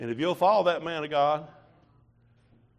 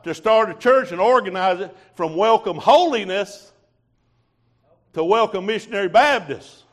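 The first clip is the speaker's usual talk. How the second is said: loud, high testimony from the pulpit; preaching at full force; 5 semitones higher, 9 dB louder than usual. Real, not acted. Preacher testifying to his church, loud and fervent. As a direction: preach it out to the congregation.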